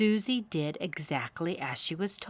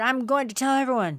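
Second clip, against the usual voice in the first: angry threat; 7 semitones higher, 7 dB louder, faster than usual. A narrator's reading. Beaver angry.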